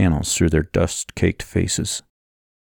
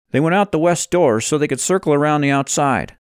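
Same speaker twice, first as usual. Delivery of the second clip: higher-pitched character explaining events; Levi, explaining; 8 semitones higher, faster than usual. Acted. Voices Levi in dialogue.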